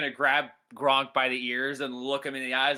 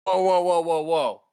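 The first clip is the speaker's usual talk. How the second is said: loud, higher string of protests; urgent intervention; 4 semitones higher, 7 dB louder than usual. Acted. Friend stopping guards taking Gronk away, loud urgent protest. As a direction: call out to halt them.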